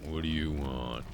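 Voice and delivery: low, soft suspicious voice; creepy neighbor. Retold or imitated. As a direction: answer the door like a creepy stranger. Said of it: "Ding dong".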